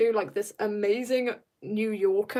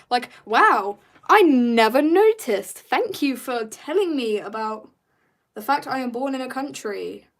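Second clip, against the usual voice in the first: mock amazement; 2 semitones higher, 6 dB louder than usual. Streamer mocking commenters who state the obvious, sarcastically. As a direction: feign huge surprise, stretching the words.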